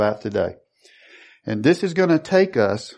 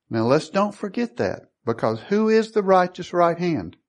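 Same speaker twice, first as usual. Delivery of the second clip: ordinary talk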